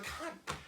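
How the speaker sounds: breathy, voiceless groan of disgust; exasperated sigh. Retold or imitated. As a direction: sigh at your idiot husband. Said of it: "And she's like".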